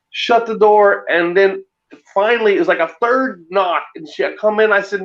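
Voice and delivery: ordinary talk, nothing marked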